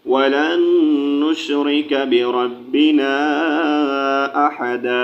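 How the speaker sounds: slow, melodic tilawat; Quran recitation. Acted. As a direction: chant the verses smoothly. Recites the verses after spelling.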